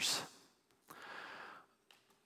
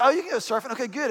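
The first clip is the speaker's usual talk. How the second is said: higher, lightly voiced pleased wife; a hint of wife; 7 semitones higher, faster than usual. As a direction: play her, bright and agreeable. Voicing his wife Julie.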